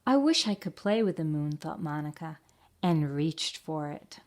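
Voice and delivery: lively picture-book reading; story narration. A narrator's reading. Teacher reading Eric Carle's book aloud to children, storybook narration. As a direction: read it out to the class with storybook expression.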